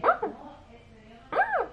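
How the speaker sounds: very high, thin mew like a crying puppy; high meowing cry. Retold or imitated. Kids mimicking a crying puppy outside, high mew. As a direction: squeak it out high like a whimpering animal. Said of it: "and they would be like".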